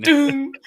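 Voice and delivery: high-pitched hollow "doom"; imitated gun thump. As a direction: mimic one launcher shot going off. Imitates face hugger guns firing.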